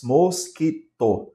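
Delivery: "mosquito" in three slow, separate syllables; teacher slowly dividing "mosquito" into syllables. Shown as a demonstration. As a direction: count out mos, qui, to in "mosquito". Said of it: "três sílabas".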